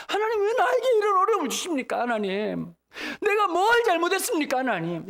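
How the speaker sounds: 높이 울먹이며 하나님께 항의하듯; 하나님께 억울함을 토하는 울먹임; 울부짖는 기도. Pretend. 기도를 상상하며 부르짖음. (목이 메어 높은 소리로 부르짖는 기도)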